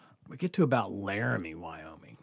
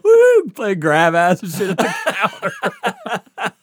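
high, loud laughter through the words; cracking up as he talks; laughing loudly; 12 semitones higher, 12 dB louder, much slower than usual. Real, not acted. Telling his friend about the hotel counter, laughing loudly.

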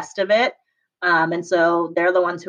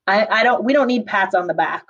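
ordinary talk, nothing marked

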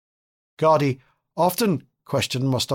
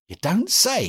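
higher, surprised exclamation; exclaiming; 7 semitones higher, slower than usual. A narrator's reading. Mostovskoy reacts to the former Tolstoyan, surprised exclamation. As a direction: throw it up in sudden surprise. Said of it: "exclaimed Mostovskoy".

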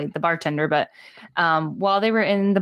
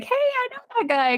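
a sudden high, excited call of recognition; "Hey, I know that guy" jumps way up in pitch. Retold or imitated. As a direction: spot an old classmate on screen and light up. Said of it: "So I was like".

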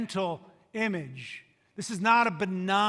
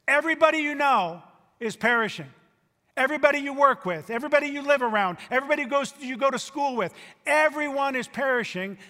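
higher and louder, pressing fast; urgent preaching; 5 semitones higher, 4 dB louder, faster than usual. Acted. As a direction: hammer it home to the crowd. Preacher building to urgent climax.

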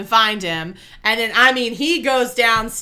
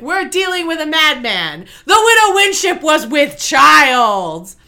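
dramatic announcement; 5 semitones higher, 7 dB louder than usual. Retold or imitated. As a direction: proclaim it like a grave verdict. Ichabod's dramatic reveal.

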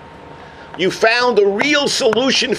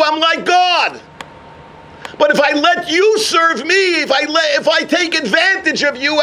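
Avraham's plea; 7 semitones higher than usual. Acted. Avraham addresses the people.

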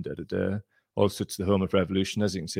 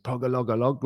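mock Catalan shout; 4 semitones higher than usual. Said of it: "they shouted, like, something like".

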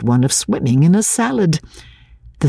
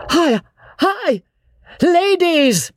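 urgent warning call; slower than usual. A narrator's reading.

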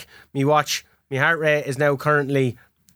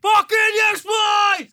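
victory roar; much slower than usual. Real, not acted. Gamer celebrating the win with his mate, roaring. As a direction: bellow it at full-time. Final whistle comeback win.

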